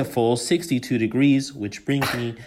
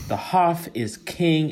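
ordinary talk, nothing marked

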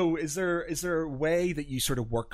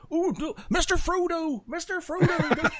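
high, slow, laughing Samwise voice; slower than usual. Shown as a demonstration. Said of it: "I automatically go on".

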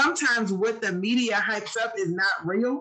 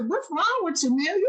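higher, teasing "what's wrong with you"; imitating Aunt Michelle; 7 semitones higher than usual. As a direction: mimic the aunt poking fun. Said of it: "that's exactly how you would say it".

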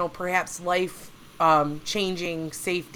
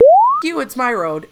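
indignant retold outburst, louder at the start; mock angry farmer; 10 semitones higher, 10 dB louder than usual. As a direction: act out a defensive farmer's loud retort. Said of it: "all these farmers are like".

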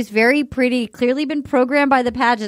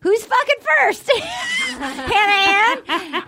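mock shout; 9 semitones higher, slower than usual. Mimicking host's loud outburst.

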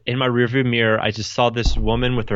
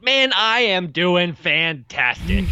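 slow, higher, overdone cheer; mock fantastic; 8 semitones higher, much slower than usual. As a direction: ham up how great you are. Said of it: "break it and be like".

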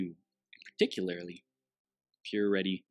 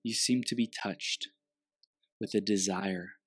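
guided meditation. Acted.

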